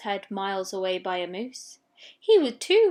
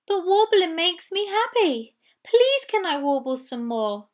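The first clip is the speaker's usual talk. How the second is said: higher, begging little voice; pleading Warble; 7 semitones higher, 6 dB louder than usual. Acted. Warble begs the huddle to let her warble, higher. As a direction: plead sweetly for more.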